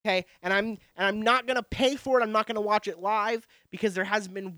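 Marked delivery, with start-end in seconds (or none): none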